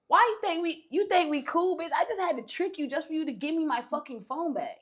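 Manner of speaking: mock yell, telling her off; angry confrontation. Confronting her after trick.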